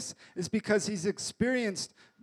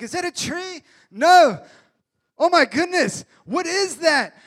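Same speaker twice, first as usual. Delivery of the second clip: high and much louder, alarmed at the sight; startled disciples; 6 semitones higher, 9 dB louder than usual. Retold or imitated. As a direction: shout out in startled disbelief. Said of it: "they're like".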